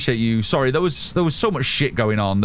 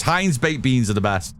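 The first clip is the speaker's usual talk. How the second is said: ordinary talk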